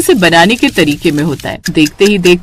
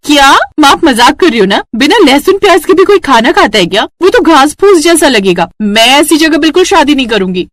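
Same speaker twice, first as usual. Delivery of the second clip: high, louder outburst of disbelief; shocked protest; 5 semitones higher, 4 dB louder than usual. Acted. Daughter appalled by a garlic-free household, shocked protest. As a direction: protest in indignant disbelief, pitched up.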